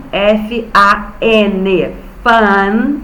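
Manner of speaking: "F A N" each letter drawn out very slowly; spelling out slowly. Shown as a demonstration. Teacher spelling for first graders, very slow. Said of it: "eu tenho aqui F A".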